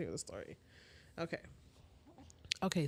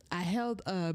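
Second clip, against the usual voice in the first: ordinary talk, nothing marked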